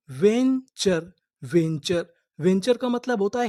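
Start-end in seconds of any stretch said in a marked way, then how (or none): 0.1-1.1 very slow; slow drawn word. Shown as a demonstration. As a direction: stretch venture out for learners. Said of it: "पूरी meaning". (धीरे खींचकर बोलना)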